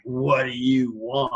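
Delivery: slow, mock-annoyed ghost demand; grudging, slow retold challenge; pissed-off ghost. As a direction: lightly imitate an irritated spirit, drawn out. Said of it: "they're usually pissed off".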